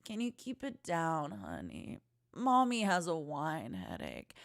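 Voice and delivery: put-on a quiet, drained plea to the kids; hungover mom. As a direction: hush it like your head is pounding. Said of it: "you wouldn't be yelling it dramatically".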